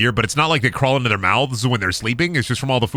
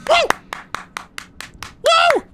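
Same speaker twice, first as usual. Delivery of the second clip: excited whoop. Real, not acted. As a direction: whoop in triumph. Cheering at a kill.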